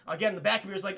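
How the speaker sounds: normal talking voice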